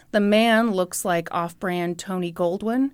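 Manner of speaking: ordinary delivery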